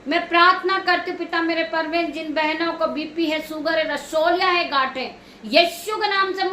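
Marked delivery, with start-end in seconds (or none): none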